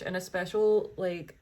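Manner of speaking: usual speaking voice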